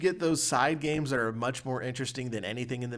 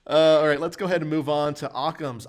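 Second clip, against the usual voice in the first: host segue; 6 semitones higher, 9 dB louder than usual. Real, not acted. Host switching segments.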